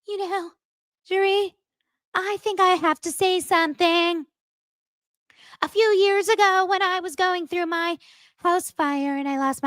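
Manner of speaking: high, put-on whiny voice; imitating Camille. Camille airing old grievances to Dorit in a high mocking voice. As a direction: mimic a self-pitying complainer, pitched high.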